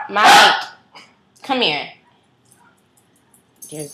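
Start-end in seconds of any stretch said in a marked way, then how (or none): 0.1-1.9 loud high call across the room. Real, not acted. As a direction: shout for Mike to come over. Calling the dog Mike over.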